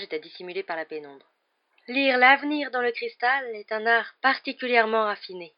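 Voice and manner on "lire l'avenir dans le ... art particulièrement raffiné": higher, louder, slow ethereal pronouncement; Trelawney's mystic voice. A narrator's reading. Professor Trelawney lecturing her divination class, in a high, slow mystic voice. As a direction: intone it like a dreamy seer. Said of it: "poursuivit le professeur Trelawney".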